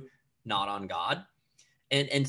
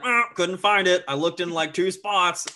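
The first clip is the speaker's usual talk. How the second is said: higher, louder mock guy voice; guy who gave up looking; 6 semitones higher, 8 dB louder than usual. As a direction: whine loudly that you couldn't find it. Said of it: "a guy is like".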